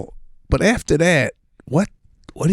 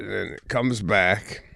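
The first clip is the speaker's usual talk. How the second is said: normal talking voice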